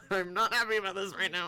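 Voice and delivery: high, loud, laughing complaint; laughing protest. Real, not acted. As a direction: whine it through laughter. Laughing protest at fighting Dawn.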